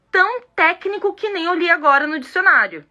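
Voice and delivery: ordinary talk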